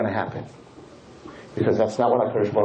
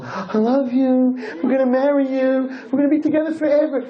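mock lover's promise; 11 semitones higher than usual. Rabbi mocking what the boy told the girl, crooning high. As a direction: ham up a sweet pledge. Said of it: "Or did you tell her".

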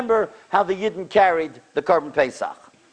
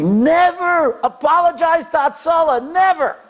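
raised, loud scolding retold; angry rebuke; 9 semitones higher, 4 dB louder than usual. Hatzalah dispatcher scolding the caller, retold, loud and raised. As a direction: scold her like an annoyed dispatcher. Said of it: "The guy in Hatzalah got so angry".

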